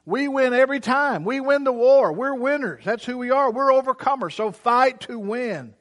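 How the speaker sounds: loud, high triumphant preaching; rally the church to victory; preaching at full force. Acted. Preaching climax to the crowd.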